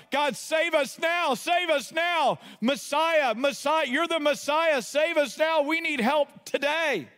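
high, pleading shout; shouting a plea to be saved; crowd crying out. Acted. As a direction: cry out desperately for rescue. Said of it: "They're crying out".

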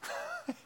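soft, high little laugh; quiet chuckle to yourself; brief amused laugh under the breath. Real, not acted. Chuckles at his own story.

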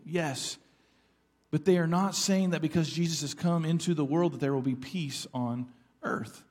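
ordinary delivery

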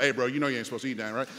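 low, quick bro voice; mock dude. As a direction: play the guy catching them eating. Voicing a man popping out.

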